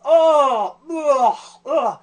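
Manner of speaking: loud, high groan of disgust; hammed-up about-to-vomit moans; mock retching groans. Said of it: "I gotta throw up".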